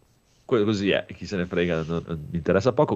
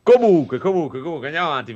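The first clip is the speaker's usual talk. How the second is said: calling everyone back to order; loud; 6 semitones higher, 7 dB louder, faster than usual. Real, not acted. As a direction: shout it to cut the chatter. Host shouting to move on.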